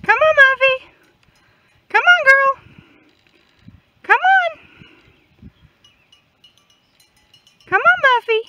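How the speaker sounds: high, loud sing-song call across the field; calling the goat. Real, not acted. Farmer calling her goat in from the pasture, high loud call.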